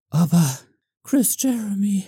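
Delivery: high, breathy pleading fan voice; breathless breathy whine. Pretend. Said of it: "höre ich euch atemlos hauchen".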